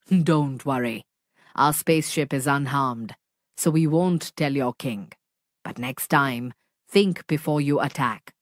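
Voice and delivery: low, gentle monster voice; kind monster. Acted. Monster reassuring the frightened friends, low and gentle. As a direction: reassure them deep and kindly.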